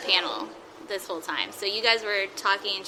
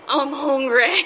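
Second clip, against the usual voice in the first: higher and louder, giggling through it; a cheeky phone prank, laughing as you say it; laughing prank line; 5 semitones higher, 6 dB louder, slower than usual. Retold or imitated.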